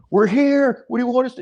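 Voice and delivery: high, comic driver voice announcing arrival. Retold or imitated. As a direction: pitch it up like a cartoon driver. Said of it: "He'll go".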